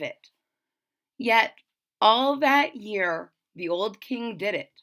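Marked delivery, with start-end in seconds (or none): none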